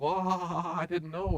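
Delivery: slow, laughing "well", a caught-out king; sheepish king. Retold or imitated. Preacher voicing a sheepish king caught by God, slow with a laugh. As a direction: bluff it off with a nervous laugh. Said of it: "The king's like".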